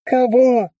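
high, loud startled question; surprised. Acted. Drone voice, surprised, high and loud. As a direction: yelp it out in surprise. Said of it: "удивился дрон".

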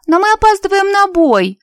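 high, loud capricious whine; spoiled rich girl. A narrator's reading. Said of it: "закапризничала Лия".

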